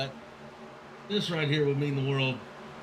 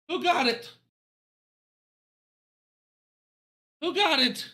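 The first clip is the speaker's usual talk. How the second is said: high, insistent excited demand; urgent questioning; 11 semitones higher than usual. Real, not acted. Announcer awaiting race control's winner, urgent excited question. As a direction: plead urgently for the result.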